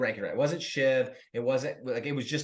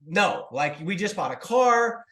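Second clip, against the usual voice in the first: ordinary delivery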